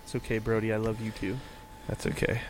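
soft reassuring. Pretend. Soothing a shy co-host.

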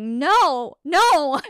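incredulous double no, rising; emphatic denial. Retold or imitated. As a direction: shut the claim down hard. Denying the medium's claim.

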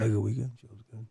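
low mumble fading to nearly silent; imitating hushed friend. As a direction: mimic talk too faint to follow. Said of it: "you started talking normal".

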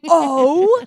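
loud, high gasped-out "oh"; shocked reaction. Real, not acted. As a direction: react to a burn, big and high.

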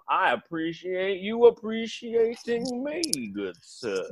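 mock-courtly southern politeness; genteel southern accent. Southerner thanking a local, genteel southern accent. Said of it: "genteel way that you guys talk".

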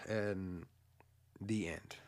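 soft voiceless the end; whispered ending. Real, not acted. Closing the movie recap, hushed whisper. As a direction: drop to a hush to close it.

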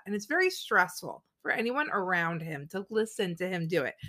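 ordinary delivery

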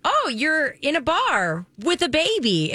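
high, slow, swooping mock surprise; Reese's movie line. Quoting Reese spotting a baby in a bar, high drawn-out imitation.

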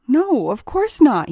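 firm, slow insistence; too emphatic denial; adamant. A narrator's reading. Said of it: "a little too adamant".

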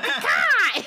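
high, loud bird caw with a laugh; eagle caw. Pretend. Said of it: "When I'm doing an eagle impression".

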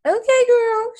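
high-pitched, loud mock acceptance; skeptical reaction. Reacting to her being a scientist now, high mock acceptance. Acts own reaction to the news.

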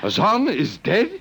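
slow, stunned high question; disbelief, slowly rising; shocked. Acted. Shock at news of death.

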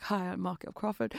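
soft, hammed-up attempt at an accent; lightly put-on, badly faked accent; bad [name] accent. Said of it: "that's a horrible accent".

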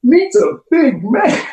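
high, slow hammed-up guy voice; silly high drawn-out impression of a friend; hugging buddy. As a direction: stretch it out in a goofy high mock voice. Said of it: "the one that hugs me and goes".

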